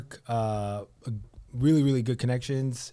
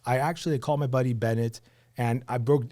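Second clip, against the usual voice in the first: usual speaking voice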